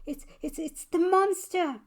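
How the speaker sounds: high, loud startled shout of fright; stammering in panic, then a high loud yelp; frightened cry. Acted. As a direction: cry out as the monster appears. Child spots monster at window.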